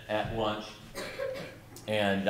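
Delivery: ordinary delivery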